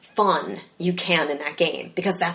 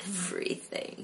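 whispered; 14 dB quieter than usual. Real, not acted. Drops to whisper on last word.

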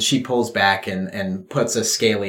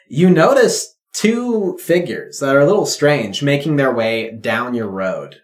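normal talking voice

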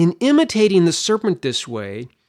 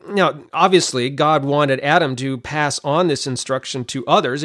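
ordinary delivery

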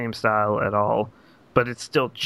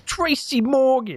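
much higher, slow, shouty put-on voice; Tracy Morgan impression; 12 semitones higher, slower than usual. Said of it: "That's how he talks".

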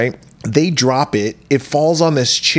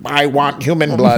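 hungry machine monster. Pretend. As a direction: growl it like a bloodthirsty machine. Voicing the Mangler machine.

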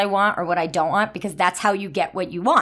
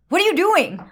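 sharp retort; 8 semitones higher than usual. Retold or imitated.

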